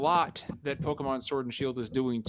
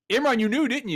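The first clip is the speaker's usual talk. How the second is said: much higher, louder playful call; loud teasing call; 10 semitones higher, 5 dB louder than usual. Real, not acted. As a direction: call him out loudly. Teasing a muted cohost.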